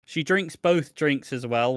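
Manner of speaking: normal talking voice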